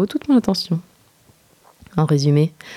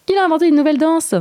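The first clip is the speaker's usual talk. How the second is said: cheerful; 11 semitones higher, faster than usual. A narrator's reading. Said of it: "en lui adressant un franc sourire".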